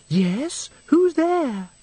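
high, airy-headed tinkling voice with a little gulp; breathless tinkle. Acted. Agnes copies her friend's way of speaking, breathless high tinkle. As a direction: chirp it up high, away with the fairies. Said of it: "that breathless tinkle in the tone".

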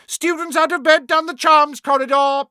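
high, very loud poltergeist bellow. A narrator's reading. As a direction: bellow it down the hall. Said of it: "Peeves bellowed".

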